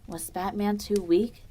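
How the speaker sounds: ordinary delivery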